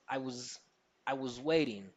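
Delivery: WAITING stands out, WAS tucked away; rhythmic contrast, weak "was", stressed "waiting"; reduced was. Shown as a demonstration. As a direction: shrink "was" to "wz" and lean on "waiting". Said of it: "we can hear waiting".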